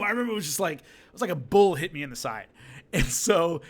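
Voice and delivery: ordinary talk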